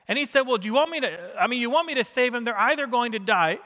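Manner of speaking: high, loud sermon retelling; impassioned preaching. Real, not acted. Preacher retelling Jesus challenged over the Sabbath, impassioned preaching.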